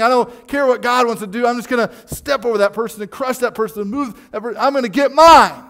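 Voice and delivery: rising fire into a full shout; preacher mocking the greedy heart, yelling; preaching at full force. Preaching climax, acted selfish voice.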